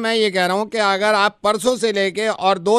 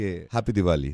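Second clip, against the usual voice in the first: very low, soft murmur; very loving; 6 dB quieter than usual. Acted. Prankster imagines his tone after surgery, mock-loving. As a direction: sink right down into exaggerated tenderness. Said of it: "बहुत प्यार से कहे किसी से".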